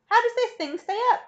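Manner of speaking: high, loud, bewildered question; anxious cry. A narrator's reading. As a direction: demand an explanation in alarm. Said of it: "cried Grandpa Joe".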